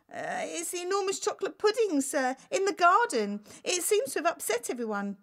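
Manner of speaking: a timid servant breaking bad news; nervous butler. Nervous butler answers king.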